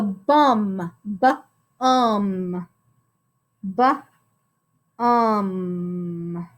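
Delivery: "bum" split into a short bu and a drawn-out um; sounding out. Shown as a demonstration. Teacher models the first syllable, sounding out. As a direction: pull "bum" apart, pausing between the sounds. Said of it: "we sound out the first syllable".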